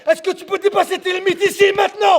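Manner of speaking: high, insistent shout; shouted challenge. Real, not acted. Coach challenging fighter to be a champion, shouting. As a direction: demand a yes from him.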